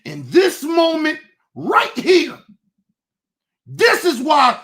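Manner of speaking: real anguish, half sobbed and half yelled; crying out in anger. Real, not acted. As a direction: shout it through real tears. Emotional climax of the rant.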